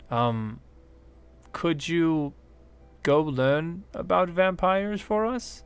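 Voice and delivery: a worried little scientist begging; gnome woman pleading. Acted. Gnome woman explains the problem.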